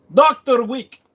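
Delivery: hammed-up high call of the name; exaggerated concierge impression. Streamer retries addressing Doctor Wick, exaggerated mock accent.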